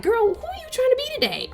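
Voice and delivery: high-pitched, quick incredulous challenge; hammed-up high-voiced disbelief; mock confrontation. Acts out confronting Georgia.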